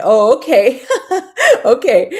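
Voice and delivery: loud, high laughing okay; real laughter round okay. Real, not acted. Reacts to chat, laughing.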